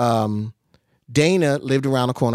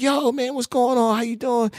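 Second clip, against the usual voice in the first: much higher, happy hey-man greeting; excited greeting; 12 semitones higher than usual. Retold or imitated. Two old friends hug and greet each other, high excited voice. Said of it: "And it was like".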